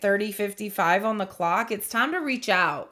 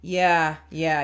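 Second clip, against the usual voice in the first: ordinary talk, nothing marked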